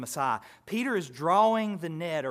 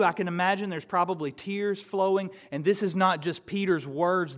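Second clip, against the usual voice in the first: ordinary talk, nothing marked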